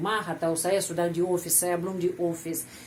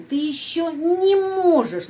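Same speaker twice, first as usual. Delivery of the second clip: "не можешь" in one breath, much higher and louder, slow; linked не; 8 semitones higher, 5 dB louder than usual. Shown as a demonstration. Teacher models joining не to the verb. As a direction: run "не" straight into "можешь". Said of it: "Jadi, sambung".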